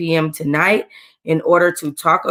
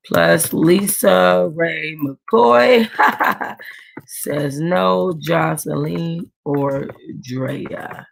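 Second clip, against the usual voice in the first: words drawn out one by one while typing; slow dictation; much slower than usual. Real, not acted. To viewers while editing the video, slow dictation. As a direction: sound each name out slowly as you type it. Retyping the same edit.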